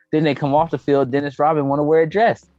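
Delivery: normal talking voice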